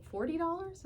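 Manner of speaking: high disbelieving question; surprised question. Retold or imitated. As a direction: ask it in surprise. Friend mishears amount, surprised.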